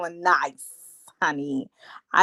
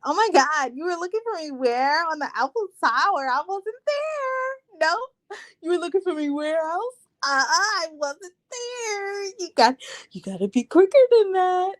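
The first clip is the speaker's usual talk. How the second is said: high-pitched, hammed-up mock whine; imitating interrupting bride; 8 semitones higher than usual. Mocking the bride interrupting the groom's vows, high exaggerated imitation. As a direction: cut in high, dragging out the protests. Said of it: "that's what she was doing".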